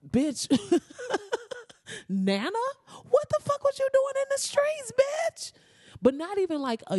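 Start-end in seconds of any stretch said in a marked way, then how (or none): 0.1-5.5 much higher, louder squeal of disbelief; shocked grandkid. Pretend. Grandkid confronting Nana about her gang past, high shocked shriek. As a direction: shriek at Nana in outrage with a laugh.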